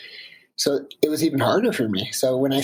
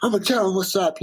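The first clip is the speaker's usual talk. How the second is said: rising, faster fired-up threat; acting out the furious climber, quick and higher; mock angry vow; 5 semitones higher, faster than usual. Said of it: "I was just mad".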